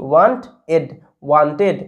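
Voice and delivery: ওয়ান্ট, এড, then joined as ওয়ান্টেড; syllable-by-syllable demo. Shown as a demonstration. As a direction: sound out ওয়ান্ট and এড apart, then blend into ওয়ান্টেড. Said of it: "এরকমভাবে পড়ছি". (ওয়ান্ট আর এড ভেঙে, তারপর জুড়ে ওয়ান্টেড উচ্চারণ)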